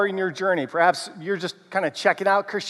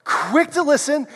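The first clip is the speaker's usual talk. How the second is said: higher, brisk; quick and bright; 7 semitones higher than usual. Shown as a demonstration. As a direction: fire it off high. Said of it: "let's do it this way".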